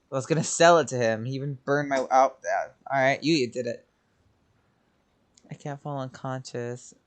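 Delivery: ordinary talk, nothing marked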